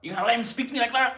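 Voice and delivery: high, fast American impression of outrage; American accent. Doing American for the livestream, high-pitched accent. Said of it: "Can you do American?".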